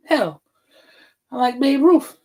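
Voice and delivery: usual speaking voice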